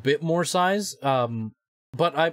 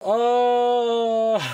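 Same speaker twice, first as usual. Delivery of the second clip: laughing; 7 semitones higher than usual. Real, not acted. After the jump scare.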